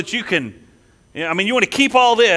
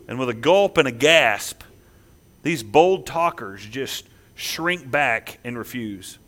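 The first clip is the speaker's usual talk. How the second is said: ordinary delivery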